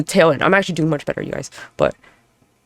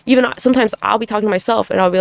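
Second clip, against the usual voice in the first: ordinary delivery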